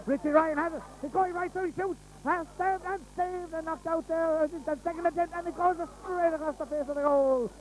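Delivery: high, urgent play-by-play; excited commentary. Real, not acted. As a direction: rush it as the shot goes in. Shot saved in live commentary.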